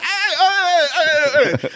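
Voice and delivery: very high imitated heys; hammed-up excited calls, laughing through it; weird stranger calling out. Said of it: "He's like".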